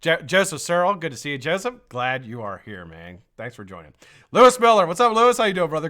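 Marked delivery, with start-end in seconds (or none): 4.3-5.9 high, loud name call; loud welcome to a viewer; excited shout-out. Real, not acted. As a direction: belt out his name happily. Calling out greeting to viewer.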